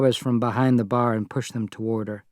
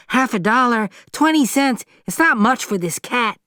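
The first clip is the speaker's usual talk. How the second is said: put-on a cheeky boy hawking a cat; street boy; 11 semitones higher than usual. Boy still haggling.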